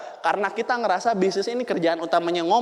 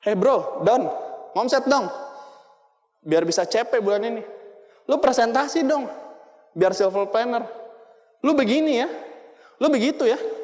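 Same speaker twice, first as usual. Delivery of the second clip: bossy upline; 5 semitones higher than usual. Retold or imitated. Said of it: "Ngomongnya begitu".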